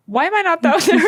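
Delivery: disbelief. Retold or imitated. Recalling watching her friend from backstage, stunned and high. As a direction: pitch it up in stunned wonder.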